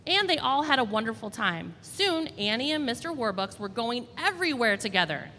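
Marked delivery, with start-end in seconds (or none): none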